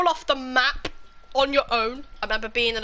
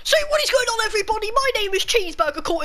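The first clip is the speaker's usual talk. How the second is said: high-pitched, energetic channel intro; video intro greeting; 9 semitones higher, faster than usual. Pretend. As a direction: launch into a big hello to the viewers.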